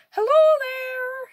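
loud, high, drawn-out call; shouting. A narrator's reading. Wolf calls across the lake to the duck, loud and stretched. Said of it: "he shouted".

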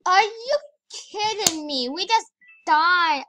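outraged at dying; shocked outrage. Real, not acted. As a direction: gasp then loud disbelief. Died in game.

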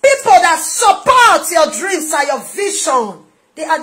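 loud, high-pitched impassioned exhortation; full-force preaching. Real, not acted. Preacher urging listeners toward supportive people, full-force preaching. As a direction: build to shouting, stretching the final phrases.

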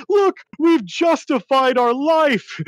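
high, loud, drawn-out jubilation; hammed-up overjoyed announcement, stretching the words; mock triumph. NBC specialist showing his commander the report, mock triumph.

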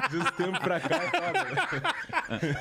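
laughing through the words; slowed, laughing reply. Real, not acted. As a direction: chuckle it out, amused. Laughing reply to interviewer's tease.

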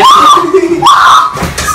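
high breathless shriek; shrieking laugh. Real, not acted. Cold water shock.

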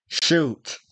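put-on the boy dares Dick to shoot him; defiant dare. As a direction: throw the word at him daringly.

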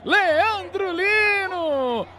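long, stretched, high goal yell; goal scream. Real, not acted. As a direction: hold the goal call as long as you can. Goal call. (grito de gol prolongado)

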